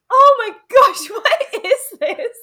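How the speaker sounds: loud, high, slowed-down mock shock; hammed-up gasp of disgust at his hand; shocked exclamation. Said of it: "and he goes".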